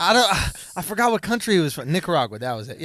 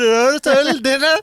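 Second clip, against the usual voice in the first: bad Indian accent; 3 semitones higher, 8 dB louder, slower than usual. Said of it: "you're just gargling with".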